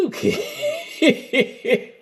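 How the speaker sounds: high, laughing okay. Real, not acted. Laughing at peeing joke.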